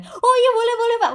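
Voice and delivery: very high, hammed-up eager yes; eager consent. Starstruck citizen answering the president's request, eagerly obliging. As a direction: agree instantly like a fawning fan, high-pitched. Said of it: "Pasti kita akan langsung kasih".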